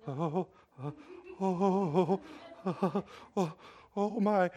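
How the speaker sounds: imitating a frightened man's soft whimpering; a mild mock-scared "oh, oh" under the breath; frightened whimpers. Bob afraid to touch the doorknob, in mock fearful whimpers. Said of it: "He's like".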